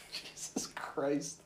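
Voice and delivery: breathy whispered Jesus Christ, slowed right down; whispered aside. Real, not acted. Friend whispering in disgust at the story, slowly.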